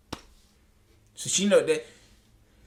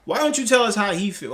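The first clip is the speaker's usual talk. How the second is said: ordinary talk, nothing marked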